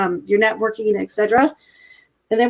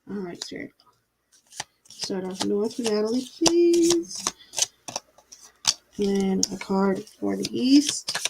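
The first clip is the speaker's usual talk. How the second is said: soft invocation to spirit; 6 dB quieter than usual. Acted. Reader quietly calling spirit guides for the card draw. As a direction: softly invite the spirits in, unhurried, hushed.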